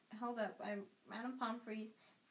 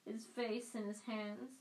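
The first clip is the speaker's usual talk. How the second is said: ordinary delivery, nothing marked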